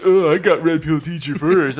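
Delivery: mocking bragging voice; lightly voiced smug podcaster; imitating Rob. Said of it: "did you impersonate him well".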